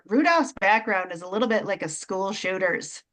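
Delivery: usual speaking voice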